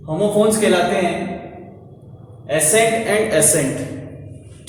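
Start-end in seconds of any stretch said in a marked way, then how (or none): 2.4-4.7 each word drawn out very slowly; slow dictation. Shown as a demonstration. Teacher slowly reading homophones to students. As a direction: spell the words out slowly for the class. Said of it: "ये दो word जो है आप पढ़ेंगे".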